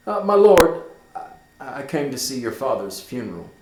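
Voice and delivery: loud start, then stammering; hesitant answer. Acted. Horatio nervously answering Hamlet, hesitant. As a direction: stumble into the answer.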